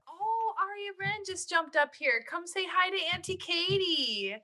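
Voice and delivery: high-pitched singsong to a beloved pet; pet-talk cooing. Real, not acted. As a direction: coo sweetly at the cat.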